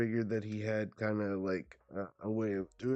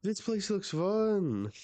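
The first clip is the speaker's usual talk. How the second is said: high slow voice; 10 semitones higher, 3 dB louder, slower than usual. Acted.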